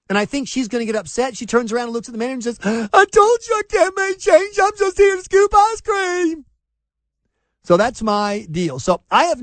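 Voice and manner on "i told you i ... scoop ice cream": put-on high, loud sulky teen girl voice; hammed-up complaining teenager; mock whiny cashier.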